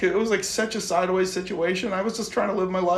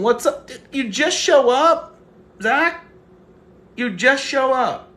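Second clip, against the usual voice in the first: mock indignant; 7 semitones higher, 8 dB louder, slower than usual. Real, not acted. Teasing a late viewer, Zach.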